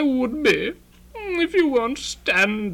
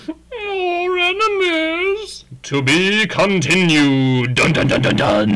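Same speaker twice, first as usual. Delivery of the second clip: cliffhanger announcer; 4 dB louder than usual. Acted. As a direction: boom out a cliffhanger with a sung-out sting. Mock cliffhanger announcer sign-off.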